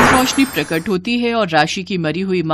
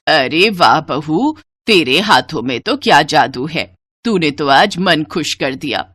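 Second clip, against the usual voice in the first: delighted mother-in-law; 4 semitones lower than usual. Acted. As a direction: praise the bride in a lower elder voice. (धीमी शुरुआत, नीची आवाज़ में तारीफ़ करती सास)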